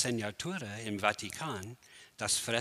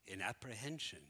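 declaimed Shakespeare, swelling louder then fading to a hush; Hamlet recitation; 5 dB louder than usual. Acted. Lecturer performing Hamlet for the audience, grand to hushed. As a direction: build the speech up, then let it die away. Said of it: "in Hamlet gefeiert".

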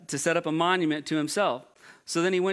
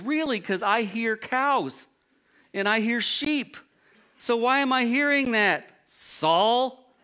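sarcastic challenge; 6 semitones higher, slower than usual. Retold or imitated. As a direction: needle him as if he already knows the answer. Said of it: "And he goes".

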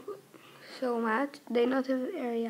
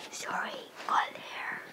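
whispering; 9 dB quieter than usual. Acted. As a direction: breathe it as an aside. Whispered aside.

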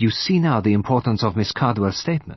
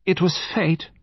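lightly voiced, higher-pitched lady; higher voice of a fatalistic lady; lady's pronouncement; 8 semitones higher than usual. A narrator's reading. Lady Chevenix Gore pronounces on the death.

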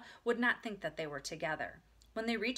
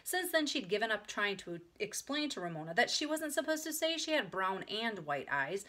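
ordinary delivery

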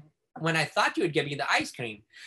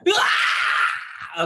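short, louder fake wail; a child's yell to get attention; mock cry shout; 5 dB louder than usual. Child denied ice cream lets out a short mock yell.

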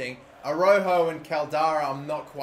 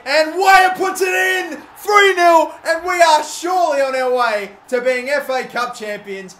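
goal celebration shout; 11 semitones higher, 11 dB louder than usual. Real, not acted. Gamer screaming as Weah scores the third. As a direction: belt out the clinching goal.

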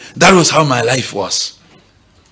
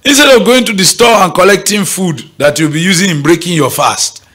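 normal talking voice

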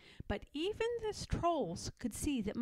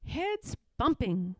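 ordinary delivery, nothing marked

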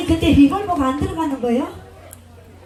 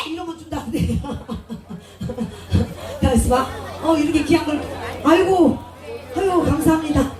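ordinary delivery

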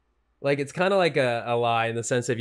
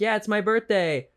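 higher, breezy birthday fib; bright fib; 8 semitones higher than usual. Retold or imitated. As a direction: sell the lie cheerfully. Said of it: "If you're like".

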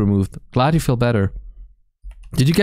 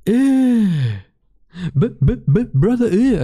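high, stuttered mock disgust; mimicked high-pitched meme voice; meme video impression; 9 semitones higher, slower than usual. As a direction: play the catchphrase like a high stuttering sample. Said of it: "The way he says it is so fucking funny".

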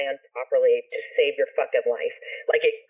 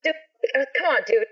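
exasperated, higher-pitched mock pleading; retold exasperated plea; 8 semitones higher than usual. Friends asking brother to stop the helicopter, exasperated pleading. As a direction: plead with him to knock it off, pitched up.

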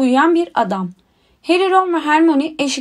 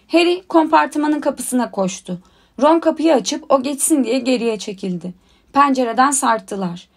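ordinary delivery

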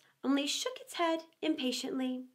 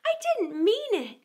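pleading; 8 semitones higher, 4 dB louder than usual. Acted. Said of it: "pleaded poor Alice".

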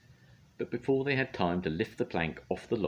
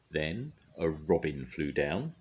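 plain reading voice

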